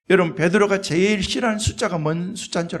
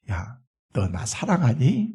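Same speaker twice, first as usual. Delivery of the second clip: slow, soft, tender asking; Jesus softly asking his disciple if he loves him; gentle question; 8 semitones lower, 5 dB quieter, slower than usual. Retold or imitated. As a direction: ask it slowly and gently. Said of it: "라고 물었어요".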